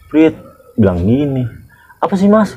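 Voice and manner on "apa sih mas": high irritated retort; mock grumpy passenger; annoyed old man. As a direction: snap back, annoyed. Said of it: "Kata dia gitu kan".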